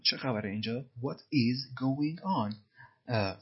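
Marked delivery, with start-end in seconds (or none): 1.0-2.6 "What is going on?" with every word slow and separate; very slow full form. Shown as a demonstration. Teacher spells out the full form for learners. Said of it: "ببین اینجا what is going on".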